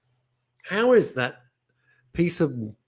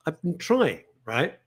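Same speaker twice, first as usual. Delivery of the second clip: normal talking voice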